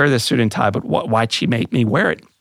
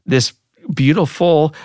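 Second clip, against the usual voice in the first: usual speaking voice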